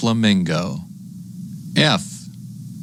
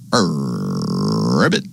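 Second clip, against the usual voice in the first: very low, long rolled ribbit rising at the end; frog croak; 9 semitones lower than usual. Pretend. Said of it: "That's the sound a frog makes".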